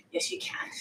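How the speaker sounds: breathy whisper to herself; whispered pep talk. Real, not acted. Quietly coaching herself after her partner asks, whispered. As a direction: whisper the encouragement under your breath. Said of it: "Is that what you say to yourself?".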